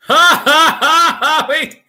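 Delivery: high, loud cackling laugh; laughing hard. Real, not acted. Cracking up at the chat.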